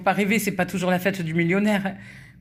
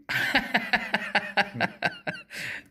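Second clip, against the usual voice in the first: laughing; 6 semitones higher, 6 dB quieter than usual. Real, not acted. Laughs at missing letter.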